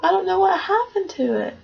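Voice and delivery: small bear cub, upset over his empty bowl; crying baby bear. Acted. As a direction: play the baby bear, whimpering a little. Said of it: "he starts crying and saying".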